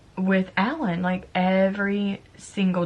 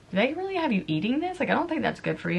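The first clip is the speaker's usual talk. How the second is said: retold incredulous question; 6 semitones higher, faster than usual. Voices concerned visitors' question.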